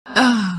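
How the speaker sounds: a small, surprised laugh on receiving a gift. Acted. Character reacts to the gift.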